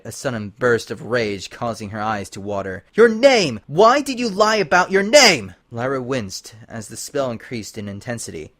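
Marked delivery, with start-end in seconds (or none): 3.0-5.5 high, loud angry shout; raging demand. Acted. Twilight demanding why Lyra lied, angry shout. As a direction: demand her name furiously.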